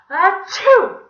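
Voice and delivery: high, very loud fake sneeze; big theatrical achoo; explosive sneezes. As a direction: sneeze violently, twice over. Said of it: "he began to sneeze".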